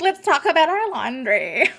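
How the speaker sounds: high, loud, drawn-out sing-song; exaggerated chirpy self-mockery, words stretched out; mock-excited self-parody. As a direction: announce it with hammed-up domestic enthusiasm. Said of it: "I'm like".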